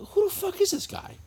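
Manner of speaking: incredulous. Retold or imitated. Retells his disbelief at an insult.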